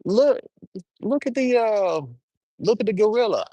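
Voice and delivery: squealing excited high pitch; like a thrilled kid spotting a gorilla. Retold or imitated. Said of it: "And they be like".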